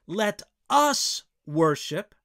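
slow, with "us" set apart and punched; stress on US. Shown as a demonstration. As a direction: lean hard on the word "us". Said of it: "the ideas of a community are there".

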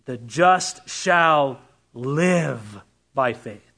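very slow, dwelling on "live"; slow, solemn line with "live" stressed. Shown as a demonstration. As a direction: lean into "live" and draw it out. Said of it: "It's on live".

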